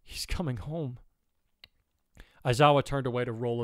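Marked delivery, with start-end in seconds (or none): 0.0-1.0 soft, tearful relief; quiet, overcome with joy; joyous tears. A narrator's reading. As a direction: say it softly through happy tears. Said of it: "as she felt joyous tears".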